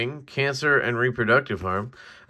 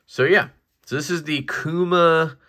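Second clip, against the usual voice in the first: ordinary talk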